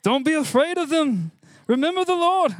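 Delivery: put-on high, loud, half-hearted stab at a Scottish Braveheart accent; a quick Scottish battle-cry in the Braveheart accent, then give up. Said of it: "I was gonna do this in a Braveheart accent".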